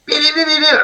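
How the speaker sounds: put-on high playful beeps like a child; kid beeping noise. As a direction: make silly beeping noises. Nephew imitating sounds in film.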